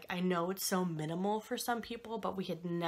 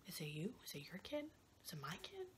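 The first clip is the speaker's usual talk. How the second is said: hushed and much softer, unvoiced; anxious whisper; 15 dB quieter than usual. Worried parents whispering in the waiting room. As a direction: whisper it to the parent beside you.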